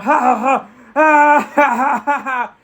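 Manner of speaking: high, loud maniacal cackle; hammed-up "ha ha ha" cackle; evil villain laugh. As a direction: throw out a big staged villain laugh. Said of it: "Kang just looks up".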